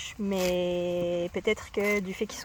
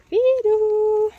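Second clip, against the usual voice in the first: calling out loud; 12 semitones higher, 12 dB louder than usual. Real, not acted. Calling the horse loudly.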